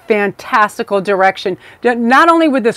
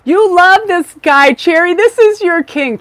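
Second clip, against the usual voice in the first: teasing delight; 8 semitones higher, 3 dB louder than usual. Real, not acted. Teasing viewer about her crush.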